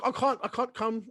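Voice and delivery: high-pitched, badly faked London accent; imitated London accent. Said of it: "let's do that accent".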